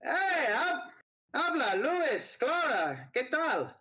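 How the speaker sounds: high-pitched woman calling across the bar; high shouted greeting. Acted. Student calls to old friends across the bar, high-pitched and bright. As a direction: call out to them from the booth. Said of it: "she shouts over at you".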